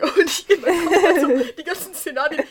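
high-pitched amused telling with laughter; laughing. Real, not acted. Laughing while telling friends about mother throwing tree. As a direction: laugh through the picture of smashed ornaments. Picturing ornaments smashing, laughing.

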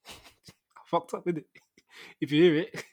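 laughing through the words. Real, not acted. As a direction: giggle it out. Laughing at own failure.

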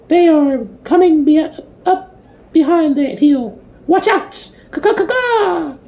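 very high screeching crow voice; shrill screeching bird woman. A narrator's reading. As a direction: squawk it out like a frantic crow. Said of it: "warned them".

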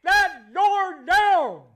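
loud, high, slow shouted vow; triumphant coach shout. Retold or imitated. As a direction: bellow it like a coach firing up the team. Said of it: "he said".

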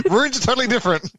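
ordinary talk

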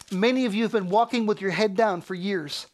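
ordinary talk, nothing marked

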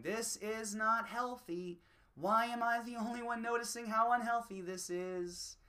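exasperated. Retold or imitated. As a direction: drag it out in mild disbelief. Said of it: "I'm just sitting here going".

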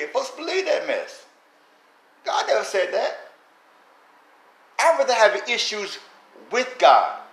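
high, loud fired-up preaching. Acted. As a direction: preach it with full force. Preaches at full force.

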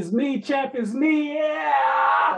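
high, excited victory shout; hype shout. Acted. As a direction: yell it like a champ. Celebrating hype chant.

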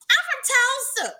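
loud high Southern accent impression. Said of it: "Southern accent".

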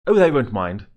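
hasty eager reply. A narrator's reading. Girl cutting off the parents' question, eager and loud. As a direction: jump in before anyone objects. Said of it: "said Annie quickly".

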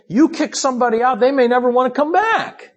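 high, quick, fervent preaching; impassioned preaching. Acted. Preacher marveling that the shut-out Lord returns, impassioned. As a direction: preach it with high-pitched amazement.

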